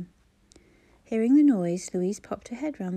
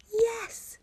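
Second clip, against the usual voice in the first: a much higher, ringing Yes; shouted together. A narrator's reading. Friends answering Clara together, a high shouted Yes. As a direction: burst out in unison. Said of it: "they all shouted together".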